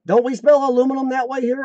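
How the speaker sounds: normal talking voice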